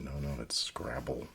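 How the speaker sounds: ordinary talk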